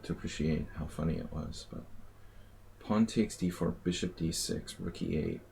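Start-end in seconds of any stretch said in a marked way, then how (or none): none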